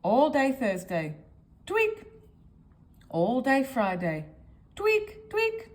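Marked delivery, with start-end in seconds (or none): 1.7-2.0 tweak sound. Acted. Sound effect of nose tweak. 4.8-5.7 tweak sound. Acted. Sound effect of nose tweak.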